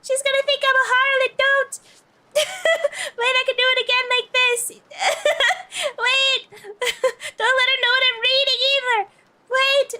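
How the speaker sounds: high, loud, giggling pleas; laughing panic. Real, not acted. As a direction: beg through helpless laughter. Panicking laughing, begging not share.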